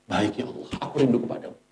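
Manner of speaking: barely voiced, yielding prayer; tender surrender. Dying soul answering God with longing, tenderly.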